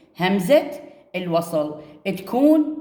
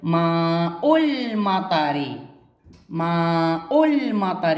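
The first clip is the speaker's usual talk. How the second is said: ماء المطر drawn out slowly, ماء linking into المطر with ال silent; very slow reading; much slower than usual. Shown as a demonstration. Teacher shows the silent ال after ماء to pupils, very slow. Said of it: "بعد كلمة ماء".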